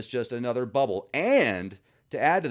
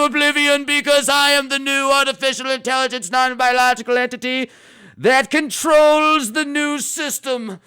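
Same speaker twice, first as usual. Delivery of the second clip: high, loud hammed-up AI voice, slowing at the end; a menacing android declaring world domination; evil robot overlord; 12 semitones higher, 9 dB louder than usual. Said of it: "And she said".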